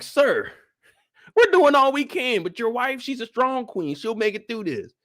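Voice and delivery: calm the king, anxiously; nervous maester. Retold or imitated.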